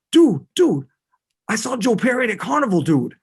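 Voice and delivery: high, loud, fast Boston dude; excited dopey Boston metalhead; Boston accent. Retold or imitated. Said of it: "talking in a terrible Boston accent".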